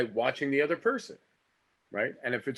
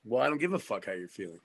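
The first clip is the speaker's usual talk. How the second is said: acted argument. Shown as a demonstration. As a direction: pick a fight with your partner. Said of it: "That was free acting class".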